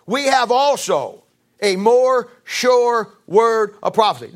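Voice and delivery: very slow, weighted word by word; emphatic scripture reading. Real, not acted. Preacher reading the key verse to congregation, emphatic. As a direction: lean on each word, pausing between phrases.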